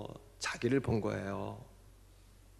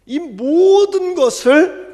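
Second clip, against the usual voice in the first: emphatic call; 16 dB louder, much slower than usual. Real, not acted. Preacher driving home the point.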